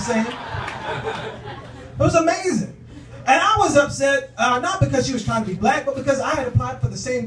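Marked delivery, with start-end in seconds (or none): 0.3-2.8 very slow, high mock amazement; mock awe. Real, not acted. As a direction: stretch it in awe.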